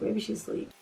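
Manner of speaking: muttered under the breath; soft unvoiced aside; whispered aside. Real, not acted. Aside while phoning late.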